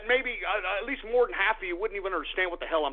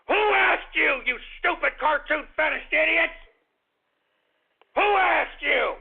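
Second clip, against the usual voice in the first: higher, louder indignant demand; angry; 7 semitones higher, 3 dB louder than usual. Real, not acted. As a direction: demand it of the hecklers in outrage. Angry rant at critics.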